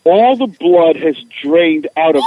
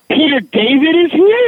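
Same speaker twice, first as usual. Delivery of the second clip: high, louder rising gasp of disbelief; starstruck disbelief; 11 semitones higher, 4 dB louder than usual. Retold or imitated. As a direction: ask it like fans who can't believe it. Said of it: "And they went".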